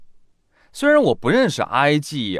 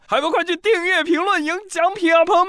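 high, loud hype call; excited urging; 4 dB louder than usual. Real, not acted. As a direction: pump up the audience. Urging listeners to subscribe.

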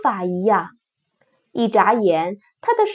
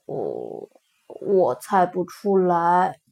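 sad; 6 semitones lower, 4 dB quieter, slower than usual. A narrator's reading. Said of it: "最后伤心地说".

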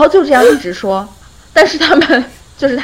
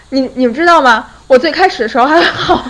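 usual speaking voice

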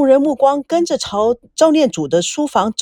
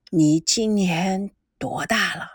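put-on slow, low old man's voice, softer; an elderly grandfather probing slowly, lower; 3 semitones lower, 5 dB quieter, slower than usual. A narrator's reading. Grandfather questions granddaughter's boyfriend, slow and low.

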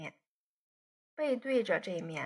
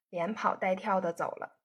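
ordinary delivery

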